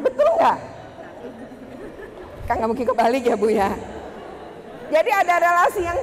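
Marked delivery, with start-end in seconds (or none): 0.1-0.6 high, laughing check with the room; laughing question. Real, not acted. Teacher laughing as she checks with the staff.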